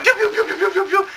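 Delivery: high pew laser noises. Retold or imitated. Everyone shooting, pewing high. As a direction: fire off high pews. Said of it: "maybe this next bullet".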